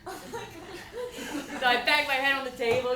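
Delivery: usual speaking voice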